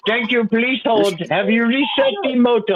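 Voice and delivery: high mock support agent voice; sing-song call center script; robocall.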